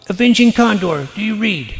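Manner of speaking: loud, high radio call. Real, not acted. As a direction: shout the code name into the radio. Calling on radio.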